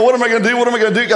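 retold panic. Driver lost on a one-way street, retold panic. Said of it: "I'm panicking here, going".

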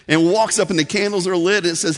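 ordinary talk

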